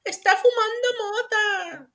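high, slow, whiny tattletale; complaining Karen voice. Retold or imitated. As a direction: squeal it like an outraged neighbor. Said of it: "alguien se queja".

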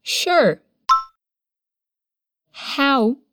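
broken into pieces with long pauses; feed it in chunks for the learner; chunked for repetition. Shown as a demonstration. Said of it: "Repete aí comigo em voz alta".

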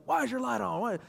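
high, quick mock indignation; mock scolding. Dad confronting daughter at bedtime, mock scolding. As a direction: pitch jumps up in an acted demand.

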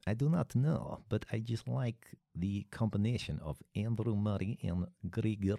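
put-on far-eastern European accent. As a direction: play Dimitrov with a thick Eastern European accent. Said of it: "you've gone quite far eastern there".

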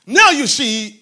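ordinary talk, nothing marked

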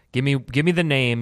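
normal talking voice

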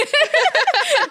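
loud high burst of laughter; real high-pitched laughing fit. Real, not acted. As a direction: crack up at the memory. Laughs at her own story.

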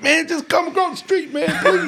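loud, very high tearful begging, hammed-up; imitating bawling. Dad pleading with son to cross the street, bawling. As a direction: beg in a big mock wail. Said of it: "so now I'm bawling, crying".

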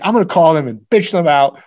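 irate. Retold or imitated. Allison vowing to call the store, irate. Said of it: "she's irate".

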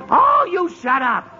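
furious bark, shut it up; angry yell. Acted. Father yelling at lullabies on the radio, angry. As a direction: snap loud and high at the radio.

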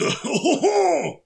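high character laugh; put-on cartoon chuckle; Blotto. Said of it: "he's, like, more like".

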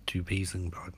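low, confiding echo; hushed echo. A narrator's reading. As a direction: let the repeat drop away almost to a whisper.

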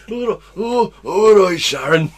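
loud, high, slow mock Yorkshire greeting. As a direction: ham up the Yorkshire hello. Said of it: "you went to uni there".

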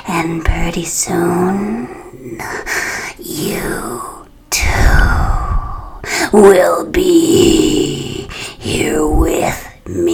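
slow, low hissing whisper; eerie ghost whisper. Acted. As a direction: drag out the threat like a corpse. Corpse threatens narrator.

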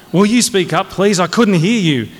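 high-pitched, forceful officious demand; irritated demand. Acted. Colonel telling a cadet to speak up, irritated. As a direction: insist impatiently on being heard.

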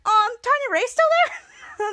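high, amazed question; surprised. Real, not acted. Host reacting to Tiny Ray's return, high surprised question.

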